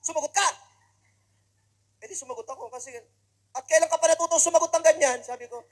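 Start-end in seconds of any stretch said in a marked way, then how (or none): 0.0-0.5 louder and higher, scolding; a mother's mock-furious demand; angry mother. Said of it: "nagagalit siya". 3.6-5.2 loud, high, outraged; furious mother yelling at her child, hammed up; angry mother. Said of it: "nagagalit siya".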